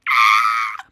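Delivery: short, low, loud "ah!"; exasperated roar, acted out; mock exasperated yell. Retelling frustration at being known too well, mock exasperated yell.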